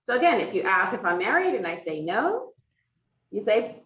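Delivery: ordinary delivery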